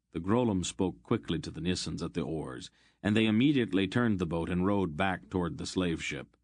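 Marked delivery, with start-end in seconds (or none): none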